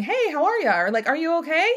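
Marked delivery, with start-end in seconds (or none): 0.0-0.8 light, high-pitched breezy hello; casual passing greeting. Acted. Somebody passing by without really asking, casual greeting. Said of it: "just casually passing and saying". 1.1-1.7 higher, rising offhand question; casual passing check-in. Acted. Somebody passing by who isn't really asking, casual check-in. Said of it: "just casually passing and saying".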